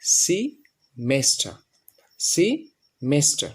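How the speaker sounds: very slow সিমেস্টার, first syllable সি; correct semester. Shown as a demonstration. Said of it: "সঠিক উচ্চারণ হচ্ছে". (খুব ধীরে সিমেস্টার উচ্চারণ)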